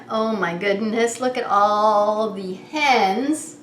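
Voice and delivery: normal talking voice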